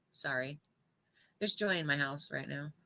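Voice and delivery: hushed apology to the congregation; quiet aside. Real, not acted. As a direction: drop the voice for an apology. Apology aside after dogs.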